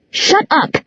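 high, sharp outburst; high-pitched irate retort; furious. A narrator's reading. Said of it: "Clary glared at Jace furiously".

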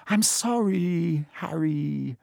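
long drawn-out, high apology; plaintive wail. A narrator's reading. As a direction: wail it slowly, full of regret. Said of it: "she wailed".

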